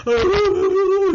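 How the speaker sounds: high-pitched imitated bawling wail; hammed-up sobbing wail; drawn-out comic cry, far above normal pitch; mock blubbering. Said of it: "I'm blubbering".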